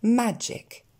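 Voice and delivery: "magic" ending in an è-like sound, majek; correct vowel. Shown as a demonstration. Teacher giving the right version with E, correct pronunciation. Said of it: "Encore une fois".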